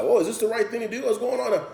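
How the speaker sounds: high, fast imitation of a worried self; doubting inner voice.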